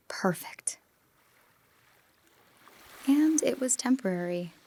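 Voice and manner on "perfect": whispered word. Acted. Memory of happy past.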